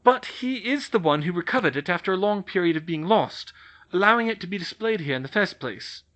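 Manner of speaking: firm older witch, lightly voiced; scolding the ambassador, lightly played; McGonagall. McGonagall argues for Harry.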